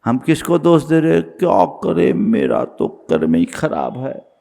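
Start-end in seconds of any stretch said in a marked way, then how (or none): 1.2-4.3 low, soft, defeated complaint; resigned lament. Acted. People blaming their own karma, resigned lament. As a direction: sigh it out as helpless self-pity.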